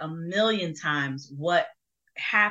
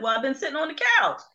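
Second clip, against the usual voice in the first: mom's excuse; 10 semitones higher, 5 dB louder, much faster than usual. Retold or imitated. Sister questions mom, mom excuses herself.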